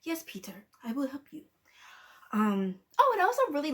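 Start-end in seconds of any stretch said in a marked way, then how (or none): 0.0-1.4 soft whispered promise; a hushed, softened Doc Ock. Retold or imitated. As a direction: whisper it gently. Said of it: "he's all like".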